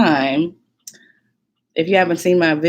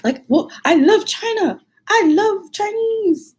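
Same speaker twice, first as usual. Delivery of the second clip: much higher, slow, nervous beginner's speech voice; imitating her nervous self; 4 dB louder, slower than usual. To the contest audience, a nervous high-pitched learner's speech. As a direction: squeak it out like a shy first-year student. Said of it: "That was my speech".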